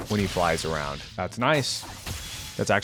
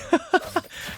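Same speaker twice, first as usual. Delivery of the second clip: high, airy giggle; amused laugh; 3 dB quieter than usual. Real, not acted. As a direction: break into a light high laugh. Laughs at crazy splash art.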